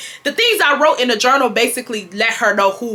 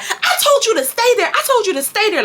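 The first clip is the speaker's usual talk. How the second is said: high, insistent scolding imitation; hammed-up exasperated mother; mom going off; 12 semitones higher than usual. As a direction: act out mom losing her patience. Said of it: "she going off on me".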